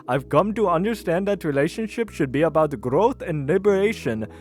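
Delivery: hammed-up Indian accent. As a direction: read it in a put-on Indian accent. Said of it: "with an Indian accent".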